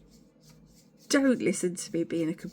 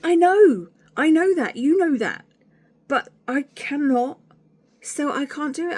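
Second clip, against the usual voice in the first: exasperated insistence; 8 semitones higher, 8 dB louder than usual. Retold or imitated. Exasperated reply to pushy friend.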